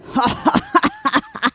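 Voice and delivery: high devilish cackle; evil laugh. Acted. Evil wizard appears and laughs devilishly. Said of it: "lachte der böse Zauberer ganz teuflisch".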